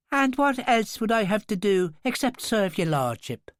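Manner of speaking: broad Irish lilt, pitched high and mocking; heavy mock-servile Irish accent; thickened Irish accent. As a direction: lay the Irish on thick to tease him. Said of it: "thickening her accent".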